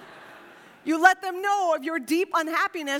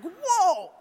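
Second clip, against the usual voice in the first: mock burst; 6 semitones higher, 6 dB louder than usual. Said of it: "it's like".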